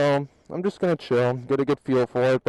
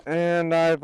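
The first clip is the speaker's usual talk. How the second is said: ordinary talk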